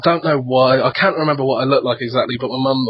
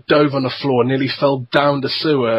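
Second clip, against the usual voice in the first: ordinary delivery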